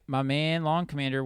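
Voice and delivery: ordinary delivery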